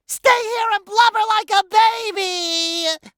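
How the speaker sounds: high, hammed-up cartoon bawling; blubbering wail. Character giving up on the plan, blubbering wail. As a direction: break into a loud wail, stretching the last word into sobs.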